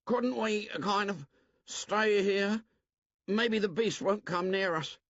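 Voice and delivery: put-on high, pleading boy's voice; timid Piggy. Piggy asks the group to stay put, timid. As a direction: beg to stay safe.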